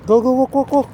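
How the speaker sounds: high, eager walking prompts. Real, not acted. Urging toddler to walk.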